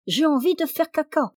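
put-on high-pitched second child chiming in; crying-out children. A narrator's reading. Other child interrupting Janvier's supper, high clamouring voice. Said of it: "les enfants se mirent encore à crier".

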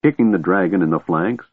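usual speaking voice

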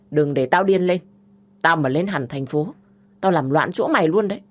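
loud, raging threats; furious threatening sister. Acted. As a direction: threaten to wreck her life.

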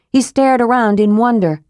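ordinary delivery, nothing marked

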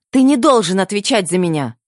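high, loud cry to her brother; shouted. A narrator's reading. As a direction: call it out urgently. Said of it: "выкрикнула я".

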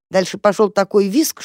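ordinary delivery, nothing marked

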